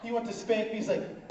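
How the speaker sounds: ordinary delivery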